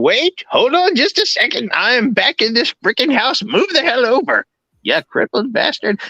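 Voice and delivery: annoyed. Real, not acted. Yells at a pet mid-ad.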